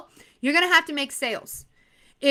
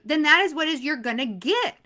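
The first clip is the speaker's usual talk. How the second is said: normal talking voice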